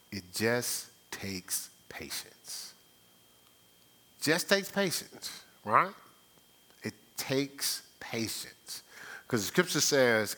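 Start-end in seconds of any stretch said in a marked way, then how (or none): 0.1-2.7 soft slow emphasis. Acted. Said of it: "preacher drops voice for emphasis". 6.8-8.8 slow breathy whisper of the point; whispered emphasis. Acted. Preacher whispering to congregation, slow whisper. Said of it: "preacher whispers the point".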